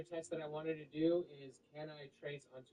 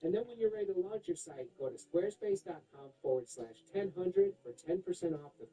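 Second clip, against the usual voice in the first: upbeat sponsor read with big pitch swings; 5 semitones higher than usual. Acted. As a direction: sell it like an excited ad host.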